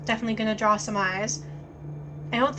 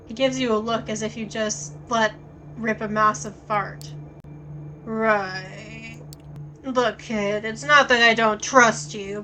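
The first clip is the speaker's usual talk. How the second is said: even game-text read; story narration; 3 dB louder than usual. A narrator's reading. Reads the game text aloud.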